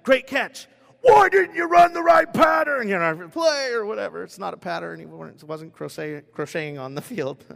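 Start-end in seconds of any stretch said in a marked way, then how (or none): 0.0-2.9 coach yelling. Retold or imitated. Coach blowing up at a player over a route, shouted. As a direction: praise then a sudden furious shout. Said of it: "Or a coach just like".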